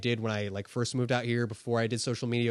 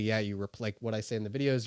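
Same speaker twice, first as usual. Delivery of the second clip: ordinary talk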